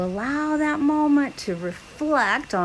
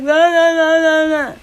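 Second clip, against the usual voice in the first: loud high wailing la la; mock distressed wailing; upset la la; 6 semitones higher, 8 dB louder than usual. Shown as a demonstration. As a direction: wail it out upset. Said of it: "If you were upset, be like".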